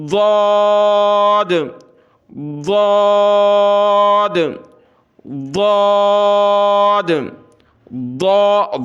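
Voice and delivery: "واد" as thick dhad, vowel held long; tongue-edge articulation. Shown as a demonstration. Teacher models proper letter, "واد" heavy and sustained. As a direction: press tongue side to molars, stretch "واد". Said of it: "زبان دائیں یا بائیں طرف لگائیں گے".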